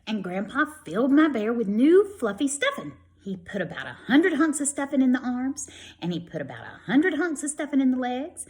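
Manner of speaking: lively storybook read-aloud; children's story voice for young listeners. A narrator's reading. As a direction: read it brightly to a class of kids. Reads picture book to children.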